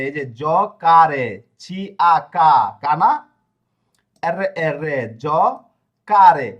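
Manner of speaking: জকারে drawn out slowly syllable by syllable; sounding out pronunciation. Shown as a demonstration. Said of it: "কর উচ্চারণ করেছে".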